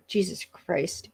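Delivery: strained, coughing through the words; choking on popcorn. Real, not acted. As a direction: croak it out after a popcorn cough. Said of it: "Sorry, my popcorn".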